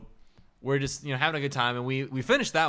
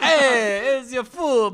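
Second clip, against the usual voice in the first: high, loud mock Italian-American waiter; 11 semitones higher, 7 dB louder, much slower than usual. Waiter serving the boys, loud hammed-up accent. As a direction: holler it as a cartoon waiter.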